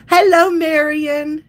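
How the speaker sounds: high, loud, drawn-out hello; sing-song greeting. Real, not acted. Streamer greeting a viewer who joins, sing-song. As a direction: call it out long and high to a friend.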